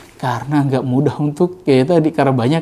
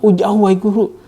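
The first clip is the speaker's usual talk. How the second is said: eager student; 8 semitones higher than usual. Retold or imitated. Students answer teacher.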